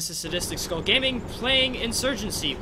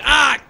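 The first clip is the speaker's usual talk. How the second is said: sharp startled yelp; 7 semitones higher, 12 dB louder than usual. Real, not acted. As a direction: cry out in shock. Sudden yelp in game.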